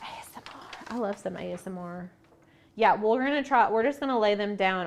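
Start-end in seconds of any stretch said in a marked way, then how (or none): 0.0-0.6 very soft, unvoiced playful whisper; mock ASMR whisper. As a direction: whisper it like a parody ASMR host.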